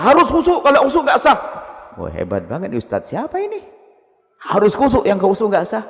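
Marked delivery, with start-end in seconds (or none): 0.0-1.4 high, loud mocking imitation of a strict preacher; mock strict ustadz. Preacher mocking a strict ustadz to the congregation, loud high imitation. 4.4-5.9 mock strict ustadz. Repeats the strict preacher's claim.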